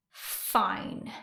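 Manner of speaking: a clipped, breathy whispered fine; terse whispered retort; curt whisper. A narrator's reading. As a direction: snap it under your breath. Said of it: "Era snapped".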